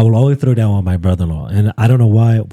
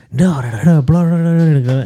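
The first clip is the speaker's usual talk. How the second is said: high, babbled mock arguing; mock protest; 7 semitones higher than usual. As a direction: imitate yourself protesting in a high blather. Retold babbling protest in argument.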